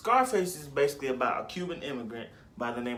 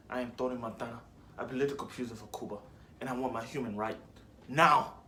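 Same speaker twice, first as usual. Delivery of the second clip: put-on Tony Montana accent; faster than usual. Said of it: "say it in my Tony Montana accent".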